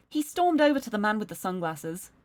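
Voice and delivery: ordinary talk, nothing marked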